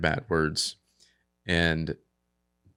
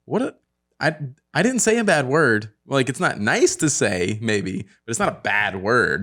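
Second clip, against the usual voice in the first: raised-pitch indignant protest; mock defensiveness; 5 semitones higher, 4 dB louder than usual. Dad to accusing kid, mock defensive. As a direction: argue your innocence, hammed up.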